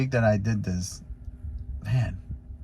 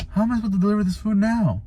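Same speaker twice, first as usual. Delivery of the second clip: much higher and fast, at wit's end; high, rushed bewildered question; exasperated disbelief; 11 semitones higher, faster than usual. Retold or imitated. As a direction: throw up your hands in disbelief. Goose circling his car.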